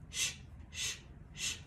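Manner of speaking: "sh" hissed softly with no voice; soft whispered consonant drill; hushed sh sound. Shown as a demonstration. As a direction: breathe out "sh" quietly. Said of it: "Sh in ship".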